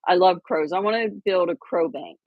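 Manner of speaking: ordinary talk, nothing marked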